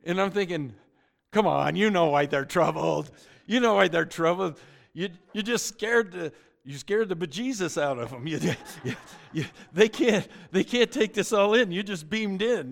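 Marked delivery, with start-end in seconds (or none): 1.3-12.7 loud, higher, incredulous scolding; mock exasperated protest. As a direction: protest loudly as if it were obvious. Acted exasperated retort to Jesus.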